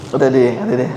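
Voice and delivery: low, soft mock reluctance; girl's quiet refusal. Preacher imitating a reluctant girl answering her father, soft and low. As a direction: brush it off softly, barely wanting to speak.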